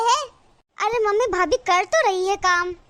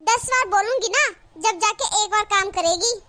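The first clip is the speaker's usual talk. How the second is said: ordinary delivery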